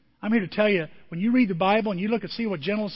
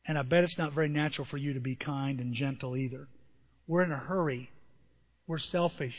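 soft sermon; 2 semitones lower, 4 dB quieter than usual. Acted. Softer intimate preaching run.

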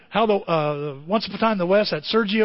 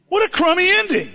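"What a crummy ending" said higher and louder, in mock outrage; hammed-up higher moan: "What a crummy ending"; exaggerated disgust; 10 semitones higher, 4 dB louder than usual. Real, not acted. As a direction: repeat it as a louder, higher complaint.

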